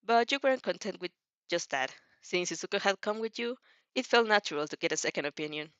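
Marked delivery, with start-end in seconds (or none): none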